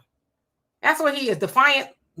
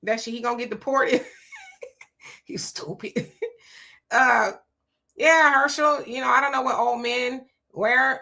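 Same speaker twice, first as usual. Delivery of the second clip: ordinary talk, nothing marked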